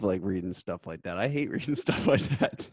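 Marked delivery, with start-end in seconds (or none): 1.2-2.7 higher voice breaking into real laughter; laughing. Real, not acted. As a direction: laugh through the words. Teasing the guest, laughing.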